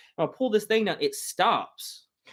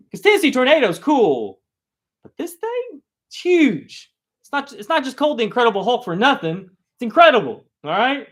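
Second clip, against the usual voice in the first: much higher and louder, wide-eyed dad warning; dad hyping the Hulk; 9 semitones higher, 8 dB louder than usual. Retold or imitated. Dad warning his son about the huge coaster, loud and high. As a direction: sell the danger, big and loud.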